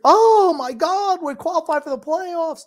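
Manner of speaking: hammed-up thrilled fan; mock fan excitement. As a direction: gush like a fan who never wins. Mocking overexcited fan.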